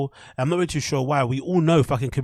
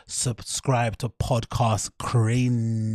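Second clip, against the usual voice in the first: drawn-out slow plug; very slow emphatic plea; much slower than usual. Shown as a demonstration. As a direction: draw out Podcast Cringe very slowly.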